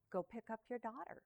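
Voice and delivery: a soft, hushed voice from nowhere; like an unseen voice calling quietly; mysterious voice. Retold or imitated. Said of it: "a voice said to her".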